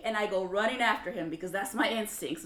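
ordinary talk

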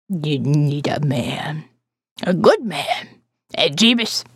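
put-on low slow old woman. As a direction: lecture her low and slowly.

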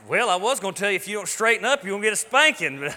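playful dad scolding his son; mock stern father. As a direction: pretend to threaten a spanking, half laughing. Father retells his mock threat.

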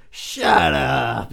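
loud, barked impression of a grumpy trainer; Mickey. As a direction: shout it like a cranky boxing coach. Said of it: "That's how Mickey talks".

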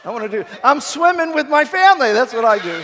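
loud, high comic comeback; mock-indignant retort. Pastor answering a nosy stranger at the pool, mock-indignant. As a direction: fire back loudly with exaggerated indignation.

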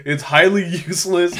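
ordinary talk

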